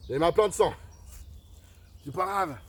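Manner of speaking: high, loud outburst; worked-up exclamation. Acted. Hunter covered in blood reassuring his followers, worked up.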